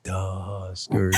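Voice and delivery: low, soft and slow; toned-down answer. Retold or imitated. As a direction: say it sweetly and quietly. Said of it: "then she tones her voice".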